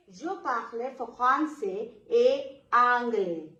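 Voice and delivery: "Je parle français et anglais" slowed, "et" said as a plain e; slow French demo. Shown as a demonstration. Teacher repeating the sentence slowly for students. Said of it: "A means that E T".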